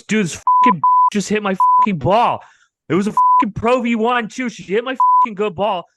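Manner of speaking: loud, high mock outrage; hammed-up ranting as the furious friend; fired-up imitation. Retelling the friend's rant about his golf ball, fired up. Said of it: "he's all fired up".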